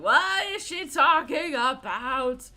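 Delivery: loud, slow baffled voice; mock baffled listener. Imitating confused listeners, loud slow bafflement. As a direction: sound utterly confused and loud. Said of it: "that don't know the story were like".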